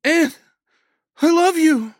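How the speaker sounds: high, full-force yell. A narrator's reading. Woman to wounded man below, yelling. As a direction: yell love down a stairwell. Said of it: "she yelled down the stairwell".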